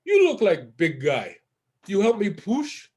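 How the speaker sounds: put-on slow, heavy Russian-accented English; drawn-out Russian broken English. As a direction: imitate a Russian man, slowly. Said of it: "he's Russian".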